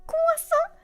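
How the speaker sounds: sudden squeaky "Croissant" mid-announcement; super high-pitched voice. Retold or imitated. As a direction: pop up very high on the name. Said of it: "super high pitch voice".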